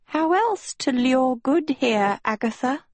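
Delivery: gently wistful question in a lifted voice; sadly. A narrator's reading. Sophie explaining her lure to Agatha, sadly. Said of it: "Sophie said sadly".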